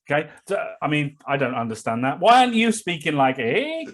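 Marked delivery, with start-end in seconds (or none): 3.4-3.9 a sharp, much higher Canadian "eh?". Retold or imitated. Host mocking the master's Canadian-sounding "eh", high. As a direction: pop "eh?" up high like a Mountie. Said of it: "talking like Constable Benton Fraser".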